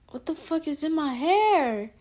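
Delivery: real surprise mid-story; startled outburst. Real, not acted. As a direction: blurt it out as something touches your hair. Sudden real reaction to hair.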